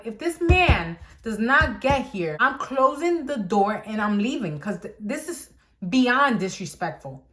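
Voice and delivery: slow, emphatic mad ultimatum; retold anger. Worker fed up with her late boss, retold mad. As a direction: lay it down word by word, fed up. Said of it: "So I got so mad".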